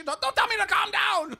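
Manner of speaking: very high, loud shrieked retort; mock furious yell. Parking lady shrieking at the friends, furious yell. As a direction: shriek it back in outrage. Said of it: "losing her mind".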